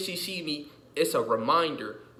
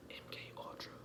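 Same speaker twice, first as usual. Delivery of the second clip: hushed unvoiced whisper; 22 dB quieter, faster than usual. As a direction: breathe it like a secret. Conspiracy aside, whispered.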